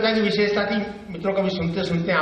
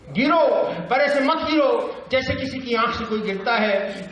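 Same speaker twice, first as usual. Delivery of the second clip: high, urgent verse recital; raised-pitch poetic appeal; Bhavani Prasad Mishra; 6 semitones higher than usual. Acted. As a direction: exclaim the command, then declaim high. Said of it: "बातचीत की शैली".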